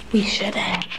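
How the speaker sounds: hushed, unvoiced aside by the door; whisper. Real, not acted. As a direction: whisper it so the friend won't wake. Sneaking by friend's door.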